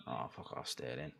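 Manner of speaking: a hushed, breathy curse under the breath; muttered curse. Real, not acted. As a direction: spit it out under your breath at the screen. Annoyed at rival player on TV.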